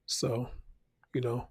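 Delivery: usual speaking voice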